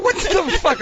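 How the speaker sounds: high surprised exclaim; mock shocked. Skirty reacting to the botched intro, shocked. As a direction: gasp it out in shock. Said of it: "Skirty's like".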